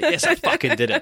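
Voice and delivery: high-pitched, playful burst of triumph; mock celebration. Actor joking about celebrating a callback, mock-triumphant. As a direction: exaggerate a little victory cheer.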